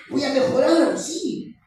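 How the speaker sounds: eager, high-pitched yes; voicing Eve. Preacher voicing Eve accepting the lie, eager. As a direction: swallow the bait gladly.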